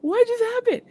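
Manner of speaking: high squeaky scream, held back in volume; mock screaming disbelief. Fan alone after meeting her idol, mock screaming. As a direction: act out a stunned shriek without full force. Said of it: "screaming at the top of my lungs".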